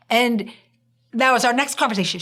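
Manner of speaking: ordinary delivery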